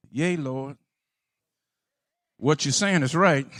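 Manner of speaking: very soft, low and humble; humble and hushed. Acted. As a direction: answer meekly, barely above a breath. Woman's humble reply, very soft.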